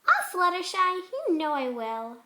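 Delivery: loud, friendly pony; reassuring a friend; lightly voiced cheery pony; fond Pinkie.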